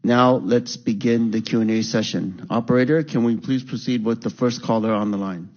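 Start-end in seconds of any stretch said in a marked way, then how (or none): none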